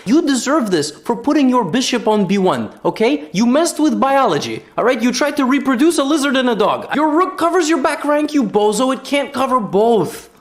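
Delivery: high-pitched comic telling-off; mock scolding. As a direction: scold the player in exasperated mock outrage.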